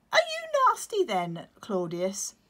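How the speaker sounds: very high, loud wondering chick; innocent chick. Acted. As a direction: ask it innocently like a toddler. Chick Otto voiced.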